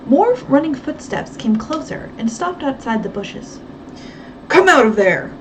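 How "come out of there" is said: loud harsh command; harsh order. A narrator's reading. Guard ordering Nancy out of the bushes, harsh loud command. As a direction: bark it out from the dark. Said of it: "ordered a harsh voice".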